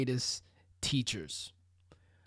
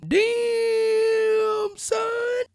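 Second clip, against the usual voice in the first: very high, loud drawn-out exclamation; over-the-top high holler; exaggerated reaction shout; 7 dB louder than usual. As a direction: stretch it way out like a hype drop. Drop-in style reaction to own question.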